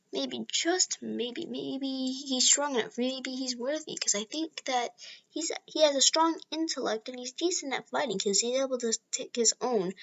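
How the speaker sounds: soft, hushed musing to himself; inner thought. Retold or imitated. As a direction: think it through quietly, almost under your breath. All Might's private thought.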